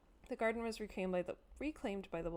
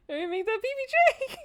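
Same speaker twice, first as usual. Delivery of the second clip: excited shriek; 10 dB louder than usual. Real, not acted. Excited shrieking about sandwich.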